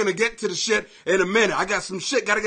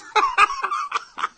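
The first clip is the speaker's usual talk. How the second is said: very high squealing laugh; squeaky cackle; real laughter. Real, not acted. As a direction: let out a high, wheezy burst of laughter. Cracks up while greeting viewers.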